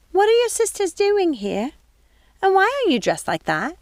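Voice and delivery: high, puzzled housekeeper voice; wavering, lost old woman; confused old woman. Acted. Mrs Tittlebottom asks Gothel about her fall, confused.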